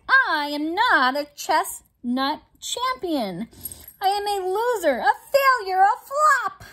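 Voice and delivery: a mock-tragic lament building in pitch; wailing in despair. Bismarck bemoaning his loss to his friends, rising wail. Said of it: "Bismarck cried".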